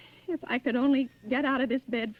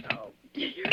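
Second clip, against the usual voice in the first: ordinary talk, nothing marked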